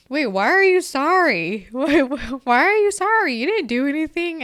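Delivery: high, loud imitation of a baffled child; puzzled son. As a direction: retell a son's bewildered questions. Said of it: "my son was just like".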